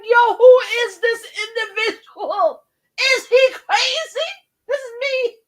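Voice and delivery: high, stunned "who is this" reaction; shocked disbelief. Retold or imitated. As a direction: squeal it in amazed disbelief.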